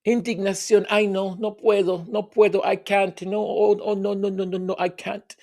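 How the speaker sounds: mock indignation in a Spanish accent; indignant Spanish accent. Mimicking the woman refusing to make a statement, indignant Spanish accent. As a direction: play scandalized refusals with a put-on Spanish accent. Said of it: "I don't know why I'm doing that accent".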